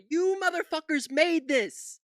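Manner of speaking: loud, slow, high outraged yell at the screen. Real, not acted. Streamer yelling at Fox News hosts, outraged. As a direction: shout it in disbelief at the TV hosts.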